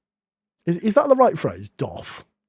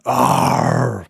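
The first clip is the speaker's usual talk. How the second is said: lion growl; 9 semitones lower than usual. Pretend. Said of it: "It sounded a bit like a lion".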